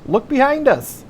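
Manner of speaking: urgent high-pitched yell; shouting back. A narrator's reading. Jet ski driver telling Derek to look back, shouting. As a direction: holler the warning way up high. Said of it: "I shouted back".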